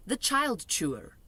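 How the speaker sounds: ordinary delivery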